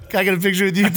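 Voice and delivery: asking through laughter; can barely get the words out for laughing; laughing while retelling the photo request. Real, not acted.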